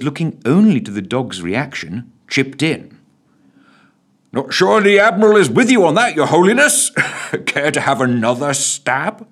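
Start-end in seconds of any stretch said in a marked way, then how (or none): 4.3-9.3 high, loud teasing constable. Acted. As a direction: tease the minister with a grin. Constable character voice.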